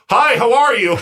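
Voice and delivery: high, loud mock-cheery holler; hammed-up shouted welcome; screamed greeting. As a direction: call a hello across the dining room. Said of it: "had to scream from across".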